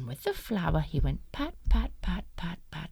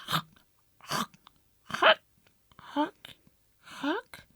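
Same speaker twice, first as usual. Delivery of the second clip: chalk coughing; 10 semitones higher than usual. Acted. Wolf swallowing chalk.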